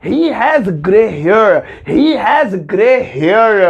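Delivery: very slow, each word spelled out; slow teacher demo; slow model sentence. Shown as a demonstration. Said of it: "बल्कि सही sentence होगा".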